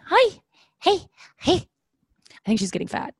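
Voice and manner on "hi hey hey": much higher, louder sing-song greeting. Real, not acted. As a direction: coo at the dog. Baby talk to the dog.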